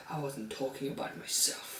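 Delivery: very soft, sullen imitation; brooding undertone. Cyborg answering his dad, in a hushed mock brood. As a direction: drop it right down, broodingly.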